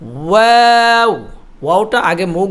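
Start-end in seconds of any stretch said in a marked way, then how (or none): 0.0-1.3 ওয়াও slow, lips rounded then spreading; rounded waw. Shown as a demonstration. Teacher showing rounded lips opening on ওয়াও. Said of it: "মুখটা গোল হবে".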